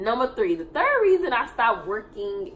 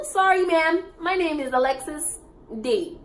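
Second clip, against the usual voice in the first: firm correction; 5 semitones higher than usual. Retold or imitated. Correcting the resident firmly.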